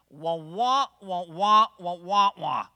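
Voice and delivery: loud, mocking wah-wah of grumblers; imitated nagging drone; mock whining. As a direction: wail it like a cartoon complainer. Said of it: "is how it sounds to God".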